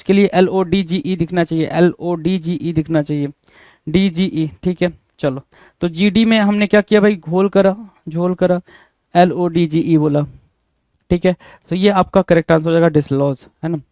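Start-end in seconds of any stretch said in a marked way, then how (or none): none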